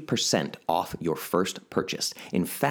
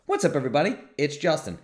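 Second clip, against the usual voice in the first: higher pitch replay of the intro greeting; quoted intro; 7 semitones higher, faster than usual. Retold or imitated. Host recalling his usual greeting, lifted voice. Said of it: "the dramatic pause in the beginning".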